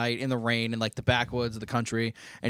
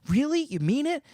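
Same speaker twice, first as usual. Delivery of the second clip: much higher, gushing disbelief; mock movie girlfriend; 13 semitones higher than usual. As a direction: parody a wide-eyed sweetheart. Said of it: "And she goes".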